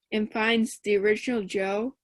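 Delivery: usual speaking voice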